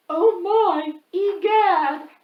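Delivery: high, loud gasping oh my; big pre-sneeze exclamation. Acted. Quentin winds up loudly before his huge sneeze. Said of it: "He breathed in deep".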